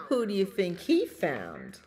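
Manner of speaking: ordinary delivery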